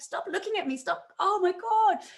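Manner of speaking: mock flustered plea ending in a high yelp. Retelling being watched while coding.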